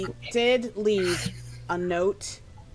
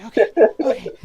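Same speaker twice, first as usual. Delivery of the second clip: flustered okay; 4 semitones higher, 11 dB louder, faster than usual. Acted. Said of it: "she's just kinda like".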